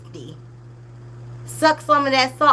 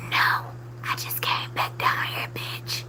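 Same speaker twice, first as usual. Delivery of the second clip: voiceless whisper, much softer, sassy; whispered retort; 8 dB quieter than usual. Retold or imitated. Vlogger whispering her own comeback to the mom. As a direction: hiss the refusal back under your breath. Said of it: "I'd be like".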